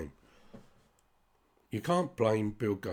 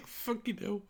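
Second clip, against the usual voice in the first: high-pitched burst of laughter into a swear; giggling fucking hell; laughing; 12 semitones higher, 4 dB quieter than usual. Real, not acted. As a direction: laugh it out in disbelief. Laughing at own lunch story.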